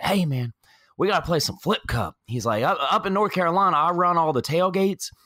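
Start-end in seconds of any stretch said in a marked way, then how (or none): 0.0-2.1 imitated North Carolina tailgater, pitched up; North Carolina accent. Tailgater pushing for a drinking game, North Carolina accent. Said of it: "he continued that North Carolina accent". 2.6-5.1 high, quick North Carolina impression; North Carolina accent. Tailgater boasting to new friends, North Carolina accent. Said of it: "he continued that North Carolina accent".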